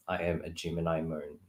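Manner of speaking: ordinary talk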